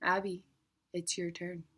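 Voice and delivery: soft, far-off hush right in the ear; buzzing in the ear. Acted. Foreign bees buzzing the name into her ear, hushed. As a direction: breathe it like a bee buzzing close. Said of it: "These foreign bees buzzed in my ear".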